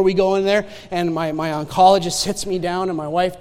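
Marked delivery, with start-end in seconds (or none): none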